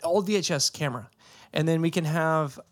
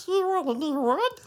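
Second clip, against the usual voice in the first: high squeaky chipmunk voice; 13 semitones higher than usual. Retold or imitated. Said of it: "Do the chipmunk voice".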